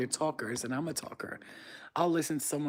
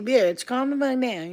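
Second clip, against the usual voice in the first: mock exasperation; 8 semitones higher, 6 dB louder than usual. Acting out scolding a texter.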